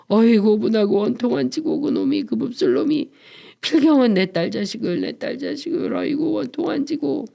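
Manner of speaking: high-pitched acted wail of grievance; wailing lament. A narrator's reading. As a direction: lament bitterly, voice rising high as if sobbing. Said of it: "이런 넋두리를 하면서 그 중에도 늙은이 하나가 설리설리 울었다". (서럽게 우는 넋두리)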